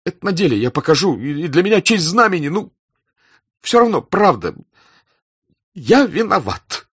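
high, loud, anguished confession; distraught, voice pitched right up; on the verge of tears. A narrator's reading. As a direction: confess in agitation, close to tears. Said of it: "Слёзы стояли у него в глазах".

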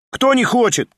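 high, jumping question; puzzled query. A narrator's reading. Schoolboy checks the confusing voting rule, puzzled query. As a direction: ask it back in sharp puzzlement. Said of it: "переспросил гимназист".

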